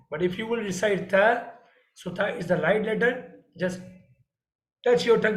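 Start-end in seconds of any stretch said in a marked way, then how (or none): none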